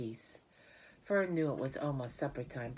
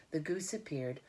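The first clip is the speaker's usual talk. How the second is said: ordinary delivery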